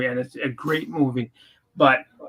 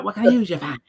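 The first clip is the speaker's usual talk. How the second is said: "for" drawn to "fah", higher pitched; mock Boston accent; 6 semitones higher, faster than usual. Shown as a demonstration. Showing how locals don't say it, overdone Boston accent. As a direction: exaggerate the dropped R in "for".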